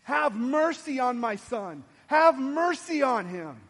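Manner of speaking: loud, drawn-out mother's plea; imitated yell, loud and pleading. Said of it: "and she's yelling".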